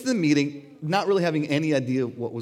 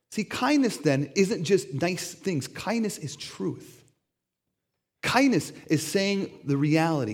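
normal talking voice

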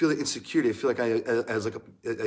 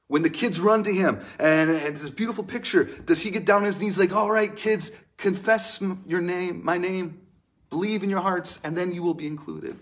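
higher and louder, a hammed-up cheery pitch to children; mock eager kids' leader; 5 semitones higher, 4 dB louder than usual. Mocking the wrong Jesus calling the kids in, bright and loud. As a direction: play it like an over-keen youth leader herding kids.

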